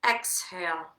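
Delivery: slow counted breathing cues; yoga breath count. Acted. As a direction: guide the breath count calmly.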